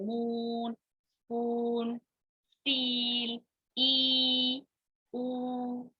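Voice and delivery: मून, स्पून, स्टील, ई, ऊ held long on a level note; long sounds. Shown as a demonstration. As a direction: draw out each vowel slowly in मून, स्पून, स्टील, ई, ऊ. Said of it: "वहां पे long बोलते हैं". (मून, स्पून, स्टील, ई, ऊ को लंबा खींचकर)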